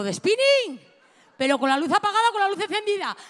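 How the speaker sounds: high, loud, fast outrage; outraged shout. Retold or imitated. Outraged at the swim teacher's suggestion, shouting. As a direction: blast it back in disbelief.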